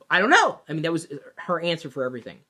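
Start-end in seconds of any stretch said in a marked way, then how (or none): 0.1-0.6 high, loud whiny "I don't know"; mock the shopper who can't decide, pitched up; mimicking the clueless customer. Salesman mimics the undecided woman customer, high and whiny. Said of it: "She said".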